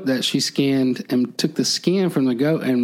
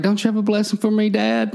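pleading Esau; 8 semitones higher than usual. Retold or imitated.

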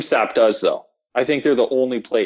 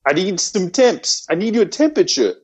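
put-on Boston accent; 6 semitones higher than usual. Voicing officer after Boston accent suggestion.

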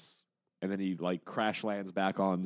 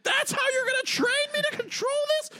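very high-pitched, louder disbelief; mock incredulous squeal; 4 dB louder than usual. [name] questioning Drax's training, high incredulous squeal. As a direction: screech it like you can't believe the plan.

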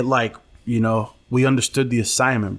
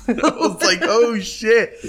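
high laughing through the words; 9 semitones higher, much slower than usual. Real, not acted. Retelling the story while cracking up.

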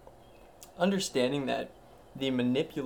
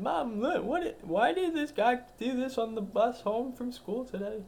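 put-on high kid voice, excited; high, excited child telling mom what he saw; 11 semitones higher than usual. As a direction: play a wide-eyed kid telling mom.

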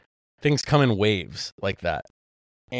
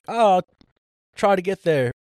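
put-on higher, half-committed British accent; 8 semitones higher, 4 dB louder than usual. Retelling what Eddie from the UK said, in a half-hearted British accent. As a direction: lightly imitate a British friend, pitched up. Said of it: "I'm not gonna do a British accent".

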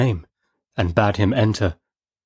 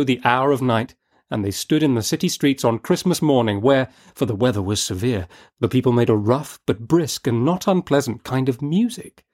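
ordinary delivery

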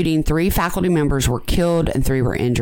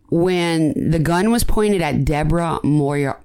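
ordinary talk, nothing marked

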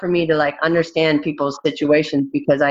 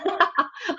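high giggle; 6 dB quieter than usual. Real, not acted. Laughing at family memory.

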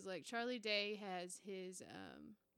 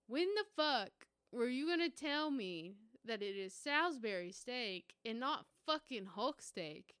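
louder, indignant yell at parents; mock-furious teen yelling; angry outburst; 4 semitones higher, 7 dB louder than usual. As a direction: demand answers, outraged. Confronting parents on coming home.

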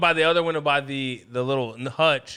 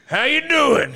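higher-pitched, cheeky burst-in greeting; mock cocky greeting; 9 semitones higher, 4 dB louder, slower than usual. Man bursting through drywall to greet those inside, playfully cocky. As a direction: call it out like a wall-busting intruder.

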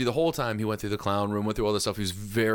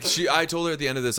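ordinary delivery

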